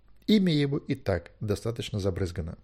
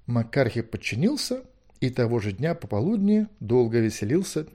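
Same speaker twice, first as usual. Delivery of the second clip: plain reading voice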